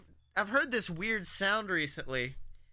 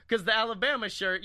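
ordinary delivery

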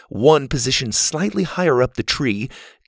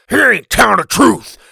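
loud, high, angry caveman; spitting angrily; 10 semitones higher, 9 dB louder than usual. A narrator's reading. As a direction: spit the words out. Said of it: "Lovekin spat".

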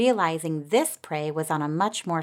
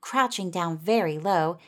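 ordinary delivery, nothing marked

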